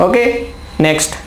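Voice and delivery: ordinary delivery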